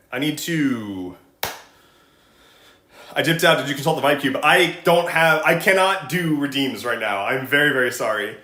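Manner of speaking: ordinary talk, nothing marked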